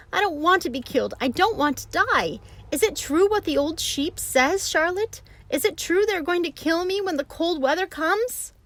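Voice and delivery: high, loud frantic pig voice; panicked young pig. A narrator's reading. Wilbur asks Charlotte if it's true, high and frantic. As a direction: plead desperately in panic.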